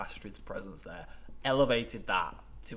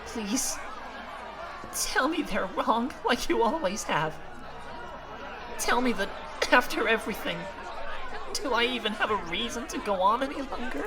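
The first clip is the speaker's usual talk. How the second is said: heartbroken plea; 11 semitones higher, 3 dB louder than usual. Acted. Character begging the silent mentor, broken. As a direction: plead as if nothing is left.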